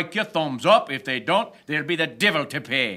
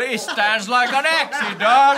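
high, louder exclamation amid laughter; amused amazement; 8 semitones higher, 4 dB louder, much slower than usual. Acted. Skipper marvels at the sailor's legs, amused and amazed. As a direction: marvel loudly at the absurd sight.

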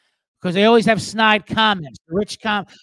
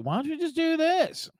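mocking uncle; 9 semitones higher, 5 dB quieter than usual. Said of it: "The uncle's always got saying some bullshit".

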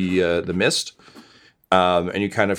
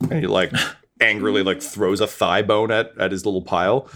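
ordinary delivery

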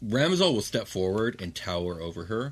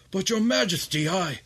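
high, interrupted protest; high-pitched startled objection; pleading son; 11 semitones higher, 4 dB louder than usual. Acted. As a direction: cut off mid-plea. Satiros protests.

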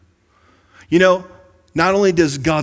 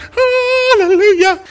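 high, slow sung-out hallelujah; joyful spirit; 8 dB louder, slower than usual. Pretend. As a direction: let the inner spirit soar up high. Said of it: "your spirit on the inside is like".

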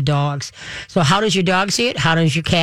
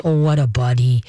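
low, calm dog-soothing voice; calm soothing praise; 5 semitones lower than usual. Shown as a demonstration. Host showing how to settle a dog, calm low praise. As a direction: drop the pitch and soothe the dog. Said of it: "very calmly with your voice".

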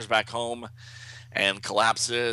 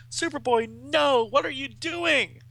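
high, drawn-out horrified exclamation; acted panic, pitched way up; alarmed cry; 3 dB louder, slower than usual. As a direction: cry out to stop a friend.

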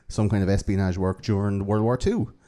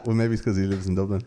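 ordinary talk

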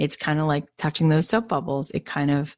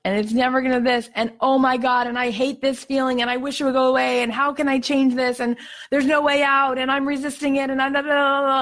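higher, pressing ranting worry; mock panicky inner voice; 8 semitones higher than usual. Someone resisting a bad feeling, in a mock anxious rant. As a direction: spiral through the complaints in a rush. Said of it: "you're like".

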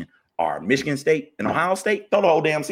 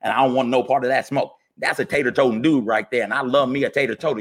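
usual speaking voice

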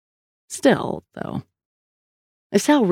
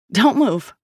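higher-pitched sharp order; contained narrator's shout, raised in pitch; shouted command; 6 semitones higher than usual. A narrator's reading. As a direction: call out a warning to freeze. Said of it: "Sal shouted".

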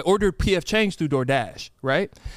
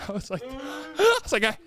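laughing; 9 semitones higher than usual. Real, not acted. Laughing through angry retelling.

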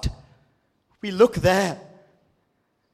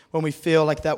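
usual speaking voice

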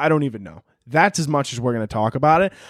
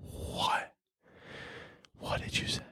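stunned, breathless whisper; mortified whisper; 18 dB quieter, slower than usual. Retold or imitated. Told Iron Man died, whispering in mortified shock. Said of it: "I'm absolutely mortified".

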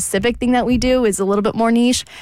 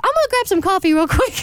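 high, cutesy pet voice; doggy voice; 9 semitones higher than usual. Retold or imitated. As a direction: talk like the dog would. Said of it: "You talk to Romeo in your doggy voice?".

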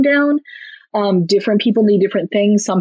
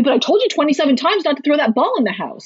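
mock exasperated parent, higher pitched; not calm yet; 6 semitones higher than usual. Shown as a demonstration. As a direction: turn the apology into a scolding. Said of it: "you're not calm yet".

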